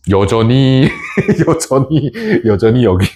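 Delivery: breaking into giggles while talking; amused, laugh-interrupted words. Real, not acted. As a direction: repeat it through a laugh. Laughs at own old ranking.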